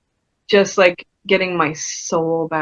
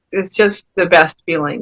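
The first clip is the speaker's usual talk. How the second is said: ordinary talk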